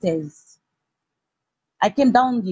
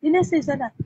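usual speaking voice

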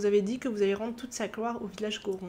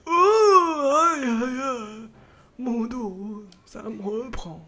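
put-on old man groaning; 4 dB louder, much slower than usual. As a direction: moan about an aching back. Old Goron's back pain.

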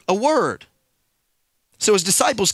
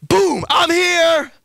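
higher, louder and slow, stamping each word; bold declaration; 6 semitones higher, 4 dB louder, much slower than usual. Retold or imitated. Billionaire urging people to kick doors down, bold. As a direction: plant your feet and announce yourself. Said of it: "you gotta come at that thing and say".

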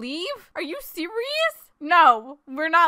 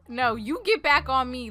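ordinary talk

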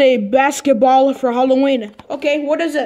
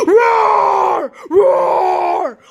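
monster roar; 6 semitones higher than usual. Pretend. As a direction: roar like a monster to scare them. Pretend monster scaring parents.

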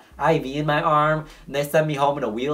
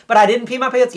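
indignant protest; 10 semitones higher, 7 dB louder than usual. Retold or imitated. Kid arguing with nurses.

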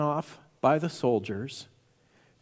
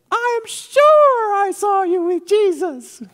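put-on very high, loud, slow child voice; insistent little slave girl; 9 dB louder, slower than usual. Slave girl accusing Peter once more, loud high squeal. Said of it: "a little slave girl".

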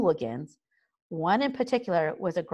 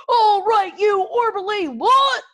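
put-on much higher, loud bellow at the boys; yelled; 8 dB louder than usual. A narrator's reading. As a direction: bark the recruits to attention. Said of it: "yelled Gobber".

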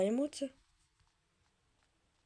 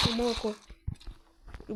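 ordinary delivery

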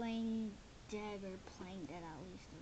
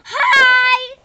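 very loud, high yelled hello; shrieked greeting; 12 semitones higher, 24 dB louder than usual. Pretend. Newcomer greeting the guys and sis, shrieking. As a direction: scream the greeting.

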